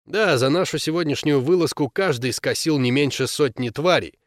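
ordinary delivery, nothing marked

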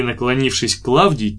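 plain reading voice

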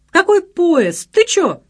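very high, loud protest; incredulous shout. A narrator's reading. Friend dismissing the train excuse, shouting. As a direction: shout up in disbelief.

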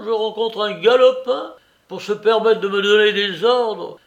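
bien plus fort et plus aigu, indigné; vieux outré. Acted. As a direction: proteste à pleine voix, vexé. Vieillard outré proteste. (Soupe s'indigne qu'on lui donne des ordres, fort)

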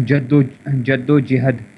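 جد و جہد spelled out slowly, jad-o-jahad; syllable by syllable, جد و جہد; slow teacher model of جد و جہد; careful pronunciation. Shown as a demonstration. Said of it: "لفظ ہوتا ہے".

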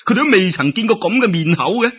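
usual speaking voice

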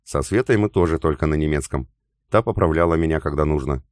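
usual speaking voice

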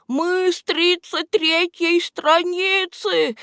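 breathless, slow high gasping cry; panting. A narrator's reading. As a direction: gasp it out, out of breath. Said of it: "задыхаясь". (задыхаясь)

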